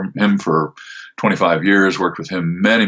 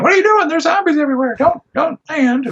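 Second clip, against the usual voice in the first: panicked wife. Retold or imitated. Wife shouting over radio, retold.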